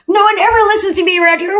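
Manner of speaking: put-on high, fast whiny child's complaint; upset child. Child complaining to parent, high and fast whiny voice. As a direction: play the hurt kid crying out that nobody listens.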